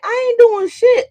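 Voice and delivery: high, loud protest; indignant denial. Retold or imitated. Answering a friend who accuses her, high and loud. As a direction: fire back high and loud, offended. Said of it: "I'm like".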